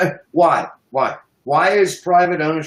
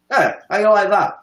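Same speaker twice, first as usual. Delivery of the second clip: normal talking voice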